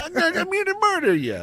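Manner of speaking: high New Jersey tough-guy threat; hammed-up New Jersey killer; New Jersey Chuck. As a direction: menace them like a cartoon Jersey thug. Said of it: "a guy named Chuck that lived from New Jersey".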